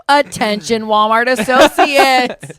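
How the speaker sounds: slow, loud intercom announcement voice; store PA announcer. Pretend. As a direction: call it out like a store PA.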